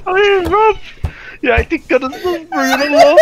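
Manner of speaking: loud, high laugh breaking through; cracking up, unable to hold it; laughing hard. Real, not acted. Friends in a laughing fit.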